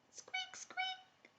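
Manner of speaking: tiny, very soft high squeaks; mouse squeaks. Pretend. Storyteller plays the tiny mouse for young listeners, faint squeaking. Mouse sound as answer hint.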